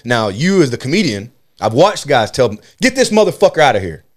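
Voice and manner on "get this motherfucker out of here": high, raised-voice mock order; imitated ejection order. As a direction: order the heckler out, pitched up. Imitating comedians ejecting a heckler.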